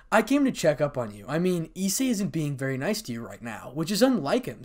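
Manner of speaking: ordinary delivery, nothing marked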